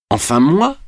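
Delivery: joy breaking out suddenly; sudden joyful exclamation. A narrator's reading. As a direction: let delight burst out, pitch rising. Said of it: "s'écria-t-il tout à coup".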